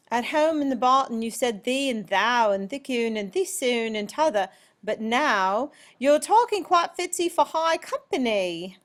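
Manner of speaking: high, loud rustic British voice; theatrical British farm-girl accent. Acted. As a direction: recite it in a put-on British country accent. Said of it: "break into the British accent again".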